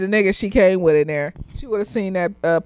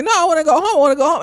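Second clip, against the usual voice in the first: insistent pleading; 7 semitones higher, much faster than usual. Retold or imitated. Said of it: "she's like".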